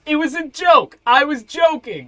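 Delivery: high, loud frantic pleading; panicked wizard backpedaling. Acted. Wizard insisting to Elias it was only a joke. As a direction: babble excuses fast like a caught wizard.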